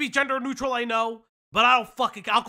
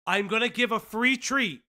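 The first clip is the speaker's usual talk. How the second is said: ordinary delivery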